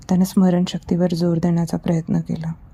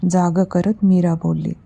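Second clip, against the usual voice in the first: ordinary delivery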